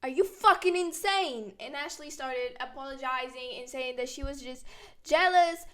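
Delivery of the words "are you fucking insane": angry yell. Retold or imitated. Shouting at Ashley on phone.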